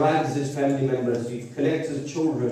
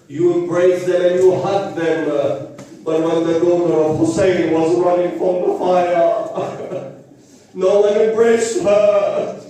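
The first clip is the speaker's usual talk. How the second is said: high, drawn-out mourning cry; slow, grief-laden sermon voice at full pitch; anguished lament preaching; 5 semitones higher, 5 dB louder than usual. Acted. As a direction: build the lament to a wailing climax.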